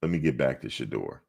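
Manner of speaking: ordinary talk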